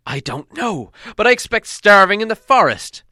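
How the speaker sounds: high, loud defiant answer; a captive dwarf snapping at the king, high and loud; exasperated Thorin. A narrator's reading.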